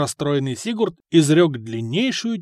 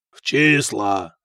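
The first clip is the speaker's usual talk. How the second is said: low, slow, drawn-out foreign figure; Dane mercenary; 6 semitones lower, slower than usual. A narrator's reading. Dane names the dice game, slow heavy mercenary. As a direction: stretch it out with heavy weight.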